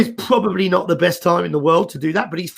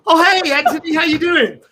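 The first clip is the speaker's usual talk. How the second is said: much higher, louder, chirpy mock greeting; overfamiliar cheer; 11 semitones higher, 4 dB louder than usual. Candidate suddenly brightens on hearing the recruiter's name, high mock cheer. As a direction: gush as if he's an old friend. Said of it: "They're like".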